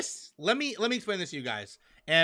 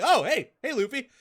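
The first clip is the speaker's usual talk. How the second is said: higher, breezy mock Zoro hello; casual greeting; 7 semitones higher, 5 dB louder than usual. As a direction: toss off a lightly voiced hi. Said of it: "He's like".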